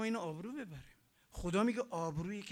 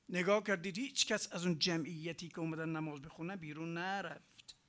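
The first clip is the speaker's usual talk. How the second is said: usual speaking voice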